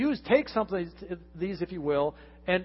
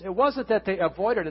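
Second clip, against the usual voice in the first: ordinary talk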